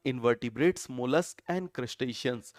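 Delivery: "Mollusc and crustaceans" drawn out word by word, very slow; slow, spelled-out recap. Shown as a demonstration. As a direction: lay out each name slowly so the class can write it down. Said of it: "हमने क्या याद किया था?". (धीरे-धीरे, हर शब्द अलग करके बोलें)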